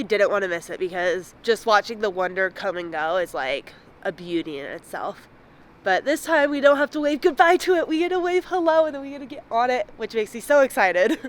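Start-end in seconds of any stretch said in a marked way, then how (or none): 5.8-11.2 high, bright and fast; bubbling over with joy; excited. Real, not acted. Ship arriving, she is thrilled.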